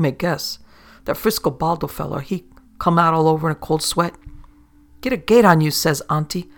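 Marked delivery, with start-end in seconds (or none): 5.0-5.7 loud, higher impatient command; loud order. Acted. Hanged pirate Auntie hurrying Frisco along, loud impatient order. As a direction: shout him into hurrying.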